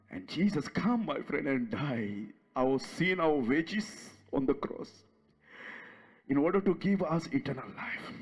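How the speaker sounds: tender appeal. Acted. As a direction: draw the listener in gently.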